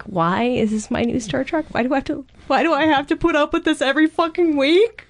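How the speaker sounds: hammed-up complaining, rising to a whine; whiny upset fan.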